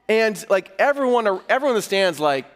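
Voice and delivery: ordinary talk, nothing marked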